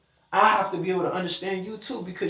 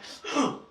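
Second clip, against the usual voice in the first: mock gasp; 10 semitones higher than usual. Acting out sudden punch.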